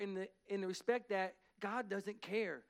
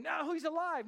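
high, loud mock scream of joy; mother screaming; 9 semitones higher, 5 dB louder than usual. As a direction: imitate an overjoyed screaming mom. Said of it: "the mom's screaming".

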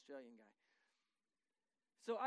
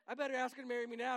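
eager rush; 3 semitones higher, 8 dB louder, faster than usual. Retold or imitated. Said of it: "I'm like".

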